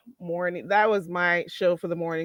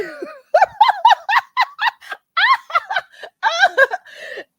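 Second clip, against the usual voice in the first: long, loud high cackle; laughing fit; 7 dB louder than usual. Real, not acted. Cracking up after telling Dory to gallop off, laughing fit. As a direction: explode into helpless real laughter. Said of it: "I'm not even laughing like that".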